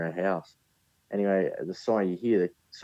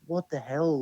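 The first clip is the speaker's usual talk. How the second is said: startled; 9 semitones higher, slower than usual. Retold or imitated. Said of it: "I was like".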